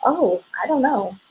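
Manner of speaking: mock helpless answer. Shown as a demonstration. Consultant shows the wrong answer to a customer, mock clueless. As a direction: play the unprepared consultant shrugging. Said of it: "no, I say".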